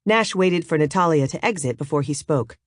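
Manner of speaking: usual speaking voice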